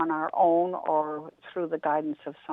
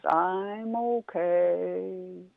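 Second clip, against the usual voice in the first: very slow, unbothered turtle; drawn-out and content, in no hurry; slow calm turtle; much slower than usual. Acted. Turtle turning down Rabbit, very slow and calm.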